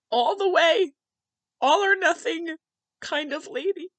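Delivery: high, strained voice through real tears; crying. Real, not acted. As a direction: choke out the praise slowly while crying. Speaker breaks down praising her mother.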